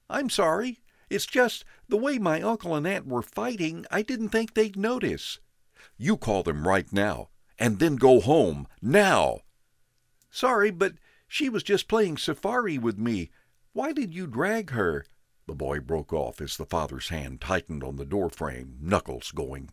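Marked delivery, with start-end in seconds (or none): 0.1-5.4 put-on high, boyish apology; small kid apologizing to the angry father; young boy. A narrator's reading. 6.0-9.4 loud, rising command; father orders the boy home, harshly; angry order. A narrator's reading. 10.3-15.0 put-on high, boyish protest; boy questions the father, upset; young boy. A narrator's reading.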